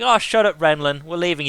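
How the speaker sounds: exasperated dismissal. Acted. Crew overruling the one who objects, exasperated. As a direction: cut him off impatiently. Voices everyone dismissing his character.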